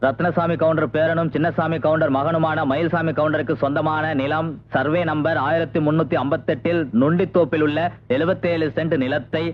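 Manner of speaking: public announcement. Acted. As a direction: read it out like a village crier over the drum. Town crier reads the auction notice.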